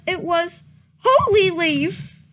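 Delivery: ordinary talk, nothing marked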